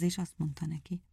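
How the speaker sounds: plain reading voice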